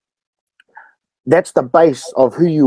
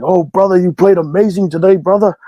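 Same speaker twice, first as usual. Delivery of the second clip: hyped-up bar patron; 5 semitones higher than usual. Retold or imitated. Bar fan gushing at the young rugby player. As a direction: praise pitched up high and eager.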